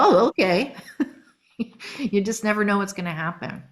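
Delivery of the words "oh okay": laughing. Retold or imitated. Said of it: "And you go".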